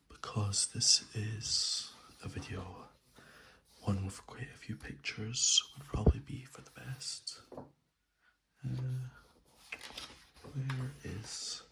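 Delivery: soft ASMR whisper; soothing spa whisper. Real, not acted. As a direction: whisper softly and close to the mic. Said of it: "I'm gonna do this in a soft spoken voice, as this is an A S M R video".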